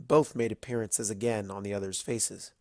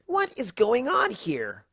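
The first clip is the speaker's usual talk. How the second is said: higher, slow demand; higher slow indignant question; demanding Rainbow; 11 semitones higher, slower than usual. A narrator's reading. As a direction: demand it sharply.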